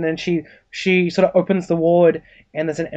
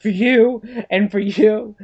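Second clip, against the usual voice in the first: high, rousing vow to each patient; Courtney; 5 semitones higher, slower than usual. Acted. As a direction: melodramatic dedication, pitched up. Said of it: "She starts pointing at all the patients".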